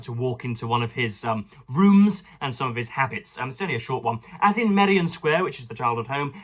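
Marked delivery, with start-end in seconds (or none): 4.4-5.4 a touch higher, measured book-reading voice; lightly lifted reading-aloud tone. Acted. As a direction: read the passage out to the listeners. Reads a biography aloud.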